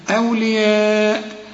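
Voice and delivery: أولياء with the madd held for four counts, slowly; long madd. Shown as a demonstration. Teacher demonstrating obligatory madd for a student. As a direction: stretch the long vowel in أولياء. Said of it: "مد واجب".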